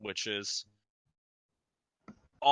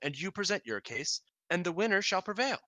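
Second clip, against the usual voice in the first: formal ruling, lifting higher at the end; a hint of a queen pronouncing judgement; lightly voiced queen; 6 semitones higher than usual. Queen setting terms for a trial, lightly regal.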